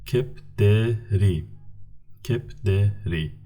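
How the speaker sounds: kepderi said slowly twice, each syllable clear; slow teacher model of kepderi; slow model word. Shown as a demonstration. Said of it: "The third word".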